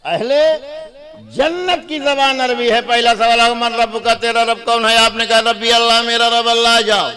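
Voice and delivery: high, ringing sermon delivery; full-force preaching. Real, not acted. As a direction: proclaim it to the whole congregation.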